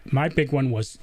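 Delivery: ordinary talk, nothing marked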